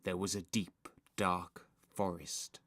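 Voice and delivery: low, soft, spooky narration; eerie hush. A narrator's reading. Narrator opening the scary film, hushed. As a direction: hush it, pausing for suspense.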